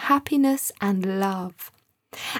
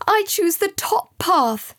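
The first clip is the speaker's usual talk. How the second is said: higher, slow, firm choice; decisive; 9 semitones higher, 4 dB louder than usual. Acted. Boy answering Sam's choice, decisive. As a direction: choose at once, no doubt. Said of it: "without hesitation".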